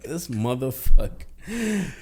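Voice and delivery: slow, drawn-out mock threat; slow imitated tough-guy sneer; menacing glare voice. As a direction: draw it out like a hostile father-in-law sizing him up. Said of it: "He looked at me like".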